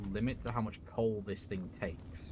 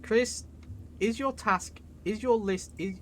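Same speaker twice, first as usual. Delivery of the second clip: calling out; 10 semitones higher, 5 dB louder than usual. Real, not acted. Calling out to Chris.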